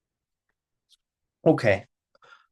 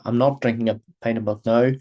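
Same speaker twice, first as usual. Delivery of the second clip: normal talking voice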